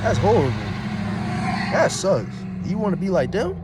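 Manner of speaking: high, loud exclamations of disbelief; incredulous outburst. Real, not acted. Ranting to viewers about small minds, loud and incredulous. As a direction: blurt it out, appalled.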